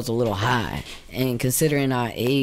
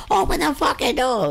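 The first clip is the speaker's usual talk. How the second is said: high mock yell of an angry mom; 14 semitones higher than usual. Mom at the bedroom door, high mock yell. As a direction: shriek it like mom pounding the door.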